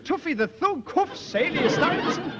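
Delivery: high incredulous yelp at the cuffs; incredulous outburst. Acted. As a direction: cry out in disbelief at being cuffed, laughing it off. Falsely arrested as Tuffy the Thug.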